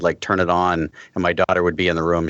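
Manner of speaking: ordinary talk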